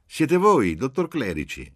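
big, good-natured voice crying out high and loud; jovial shout. A narrator's reading. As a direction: hail the doctor festively down the telephone. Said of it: "una grossa voce bonaria e festosa gli gridò". (grido festoso e bonario, a piena voce)

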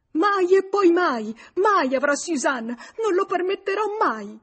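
very high, loud outburst; shrieking outrage, each mai hit hard; furious screamed refusal. A narrator's reading. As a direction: scream it with absolute defiance. Mother screams her refusal.

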